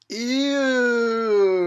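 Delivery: high, loud disgusted ew; grossed-out ew. Real, not acted. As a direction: recoil at the gore. Gross-out at the screen.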